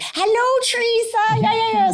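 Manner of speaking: high, loud sing-song call of a mum. Retold or imitated. Mum calling up from the kitchen in a high, loud sing-song. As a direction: call up the stairs like her mum. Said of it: "she's like".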